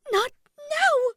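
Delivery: very high, loud self-scolding; frantic shriek at her own thoughts; admonishing herself. A narrator's reading. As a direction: squeal it in flustered protest. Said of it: "she admonished her vulgar thoughts".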